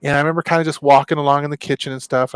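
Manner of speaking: ordinary delivery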